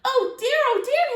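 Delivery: high, loud fussy Mister Pudding; worried elf crying out; fretful cry. Acted. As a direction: flap about in a panic. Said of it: "he cried".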